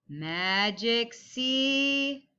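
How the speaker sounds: drawn-out slow Magic C; slow tracing call. Shown as a demonstration. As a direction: stretch it slowly while tracing. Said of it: "everybody get your pencil ready".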